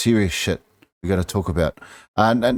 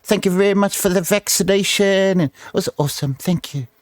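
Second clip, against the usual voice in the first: mocking gushing; 8 semitones higher than usual. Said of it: "How do you find it".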